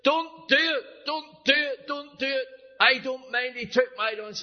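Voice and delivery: high, loud pleading cry from the back; shouting out. Retold or imitated. Boy crying out from the back of class to stop the teacher. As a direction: call out urgently to stop the caning. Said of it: "Big Jim shouts out".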